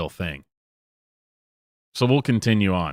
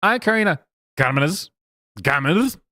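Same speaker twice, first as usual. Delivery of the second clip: mock Spanish accent on the surname, pitched up; American speech, then an exaggerated Spanish-accented "Gomez"; Karina Gomez; 6 semitones higher than usual. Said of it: "normal American until it's the last name".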